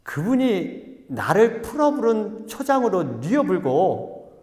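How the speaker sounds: slow, drawn-out Jeolla drawl; hammed-up Jeolla scripture reading, stretching the words; Jeolla dialect. Pastor reading the psalm's Jeolla version, hammed-up dialect. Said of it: "전라도 분이 어떻게 내 이렇게 한대요".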